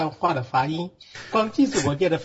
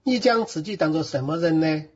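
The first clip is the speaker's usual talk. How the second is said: steady verse-by-verse reading with Sichuanese pronunciation and tones; Sichuan dialect. Shown as a demonstration. Bible student reading John for the teacher on request, in Sichuan dialect. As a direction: read scripture aloud in Sichuan dialect. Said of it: "四川话念一念".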